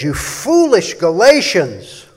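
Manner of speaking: loud, high preaching read; fiery preacher's scripture reading. Shown as a demonstration. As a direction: proclaim it like a pulpit preacher. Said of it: "gonna read it just kind of like a preacher would".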